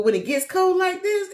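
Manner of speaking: ordinary talk, nothing marked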